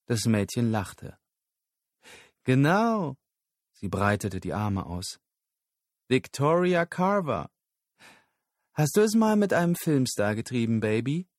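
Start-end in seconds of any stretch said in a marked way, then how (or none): 2.4-11.3 high, teasing streetwalker voice; street girl's come-on. A narrator's reading. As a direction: purr the offer high and brassy. Prostitute propositions Lyle.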